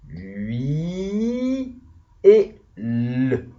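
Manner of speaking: "hui" held long, then "l" as its own beat; written syllables. Shown as a demonstration. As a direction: split huile into a stretched "hui" and a separate "l". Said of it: "Ça, c'est les syllabes écrites".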